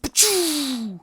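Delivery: high cartoon zoom noise; whoosh sound effect. Shown as a demonstration. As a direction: zip off with a squeaky pop. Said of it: "That kind of sound effect you make when you wanna talk about going fast".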